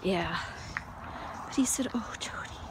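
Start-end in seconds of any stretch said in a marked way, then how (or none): 1.9-2.6 breathy whisper of comfort; soft whisper. Retold or imitated. God comforting Jody, in a soft whisper. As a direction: whisper it tenderly, barely voiced. God's tender words quoted.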